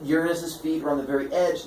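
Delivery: usual speaking voice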